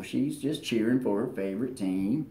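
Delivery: ordinary delivery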